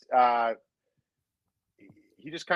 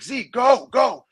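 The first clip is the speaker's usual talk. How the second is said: high, pressing shout to a teammate; urgent call; 10 semitones higher, much slower than usual. Retold or imitated. Teammate urging a daydreamer over the boards, urgent shout. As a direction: hurry him over the boards. Shoving teammate onto the ice.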